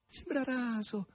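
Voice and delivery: very high-pitched gushing admiration; rapturous cries. A narrator's reading. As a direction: exclaim in raptures, drawing out the wonder. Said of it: "baciandola e gridando".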